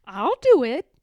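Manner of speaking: high, loud little cub; eager small bear. A narrator's reading. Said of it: "said Little Bear".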